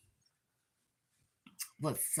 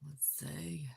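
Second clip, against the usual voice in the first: breathy whisper to herself; murmured under her breath; whispered aside; 3 semitones lower, 14 dB quieter than usual. Real, not acted. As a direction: drop to a whisper while looking. Mutters to herself while checking.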